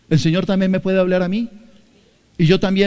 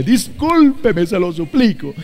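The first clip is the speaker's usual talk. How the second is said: mock pleading apology; slower than usual. Shown as a demonstration. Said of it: "Ay, perdón". ("discúlpeme" lento y más agudo, súplica teatral)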